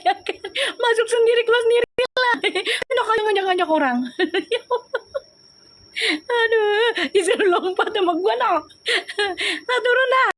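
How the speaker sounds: giggling so hard the words come out squeaky; laughing. Real, not acted. Cracking up at own joke.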